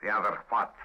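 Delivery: put-on posing as a Russian agent, accented; best Russian accent. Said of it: "in my best Russian accent".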